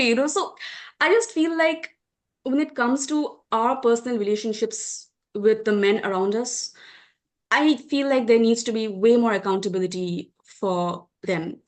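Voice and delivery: ordinary delivery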